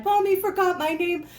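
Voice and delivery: high, mock-sad little doll voice; hammed-up doll whining. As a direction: pout as the doll. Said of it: "he's sad".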